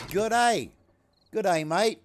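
loud, high Australian greeting; practiced Australian accent. Shown as a demonstration. Streamer greeting the chat to practice fitting in, practiced Australian accent. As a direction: call it out in an amateur Australian accent. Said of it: "practicing my Australian so I can fit in".

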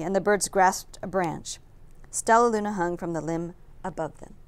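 plain reading voice

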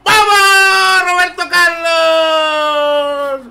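very high, loud, long-drawn shriek; goal shriek. Real, not acted. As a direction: stretch the name out in a wild scream. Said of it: "ha pegado un chillido".

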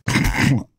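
high airy chuckle; breathy laugh. A narrator's reading. Melvin laughs at Gavin's complaint, high airy laugh. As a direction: laugh it off mockingly. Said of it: "Melvin laughed".

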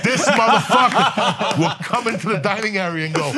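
ordinary talk, nothing marked

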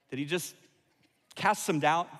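ordinary delivery

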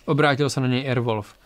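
usual speaking voice

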